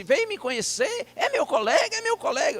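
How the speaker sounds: put-on high-pitched, eager old man's voice; excited old man. Old pastor greeting a visiting colleague, excited old man. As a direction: pipe up high, delighted and surprised.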